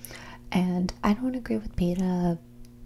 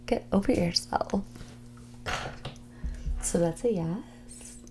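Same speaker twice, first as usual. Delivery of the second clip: normal talking voice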